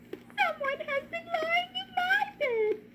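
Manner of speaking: very high tiny cub voice with a cry; little crying baby voice. Acted. Baby bear finding Goldilocks in his bed, crying. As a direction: squeak it tearfully like a cub. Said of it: "said Baby Bear in a little baby voice".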